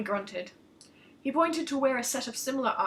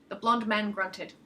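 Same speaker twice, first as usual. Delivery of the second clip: ordinary delivery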